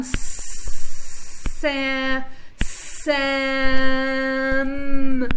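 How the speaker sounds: Sa- held into Sam with the voice kept on; slow blended Sam; stretched blend. Shown as a demonstration. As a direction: glide the sounds of Sam together. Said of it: "Keep your voice on".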